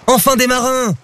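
high, relieved cry; exclaiming. A narrator's reading. Loup spots a boat at last, exclaiming. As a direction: greet the sight with high-pitched eagerness. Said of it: "s'exclama Loup".

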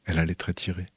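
plain reading voice

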